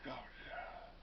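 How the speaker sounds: soft, sinking aside; quiet, barely voiced reaction; deflated aside. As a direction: drop to a near-whisper. Sarcastic reaction to retold claim.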